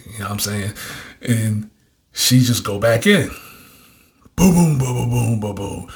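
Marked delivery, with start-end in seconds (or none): 4.4-5.9 rhythmic booming mouth noises; vocal beat, boom after boom; imitated bass beat. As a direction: mimic a thumping track.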